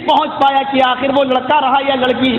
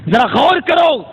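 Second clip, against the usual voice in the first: raised exhortation; 4 semitones higher than usual. Real, not acted. Preacher urging congregation.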